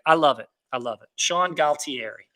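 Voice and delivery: usual speaking voice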